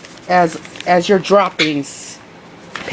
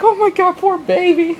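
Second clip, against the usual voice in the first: worried pet talk; 11 semitones higher than usual. Retold or imitated. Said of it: "I'm like".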